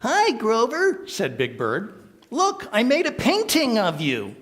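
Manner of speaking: high, loud friendly bird voice; cheerful Big Bird greeting. Acted. Big Bird showing Grover his painting, high cheerful voice. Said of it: "letting me do the voices".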